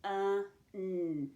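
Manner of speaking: UH, then a held N, separately; sounded out. Shown as a demonstration. Teacher tackling the first syllable, sounded out. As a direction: stretch UN into its two sounds. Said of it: "I hear that short vowel U".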